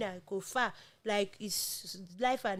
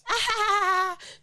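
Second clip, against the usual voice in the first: loud burst of laughter; 12 semitones higher, 7 dB louder than usual. Real, not acted. Reacts to birthday look.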